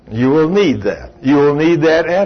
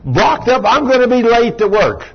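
mock traffic rant; 9 semitones higher than usual. Driver stuck in traffic complaining to God, exasperated. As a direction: gripe at God from the traffic jam. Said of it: "you say".

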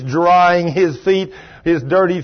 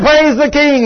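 much higher, loud call of praise; joyful praise; 12 semitones higher, 8 dB louder than usual. Retold or imitated. Dave answering the preacher, joyful shout. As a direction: ring it out gladly. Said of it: "he says".

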